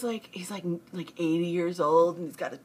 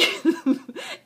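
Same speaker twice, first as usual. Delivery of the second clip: high laugh; 10 semitones higher, 4 dB louder than usual. Real, not acted. Bursts out laughing.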